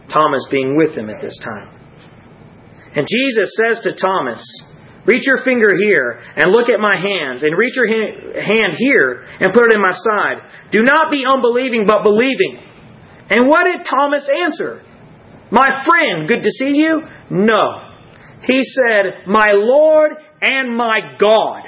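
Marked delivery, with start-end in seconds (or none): 2.9-19.3 raised, urgent preaching voice; impassioned preaching. Real, not acted. Preacher retelling Jesus's words to doubting Thomas, impassioned. 19.3-21.6 slow, high, drawn-out proclamation; exalted declaration. Retold or imitated. Thomas answering the risen Christ, exalted and reverent.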